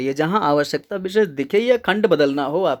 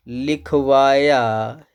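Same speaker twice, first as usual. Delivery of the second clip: लिखवाया stretched very slowly; slow dictation; 3 semitones lower, much slower than usual. Shown as a demonstration. Teacher dictating causative verb examples, very slow लिखवाया. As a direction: draw लिखवाया out piece by piece as for note-taking.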